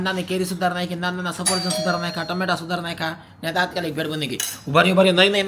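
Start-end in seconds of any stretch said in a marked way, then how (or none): 4.7-5.5 sudden wait call. Real, not acted. Doorbell interrupts, loud.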